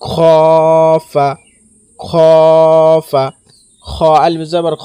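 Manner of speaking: খ held very long and loud, ফা short; one-alif elongation. Shown as a demonstration. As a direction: stretch খ in খফা, then release a quick ফা. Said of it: "এখানে এক আলিফ পরিমাণ টান দিতে হবে".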